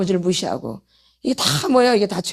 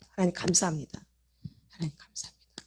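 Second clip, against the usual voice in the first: soft thanks; 3 semitones lower, 10 dB quieter than usual. Pretend. Grateful prayer after doing well.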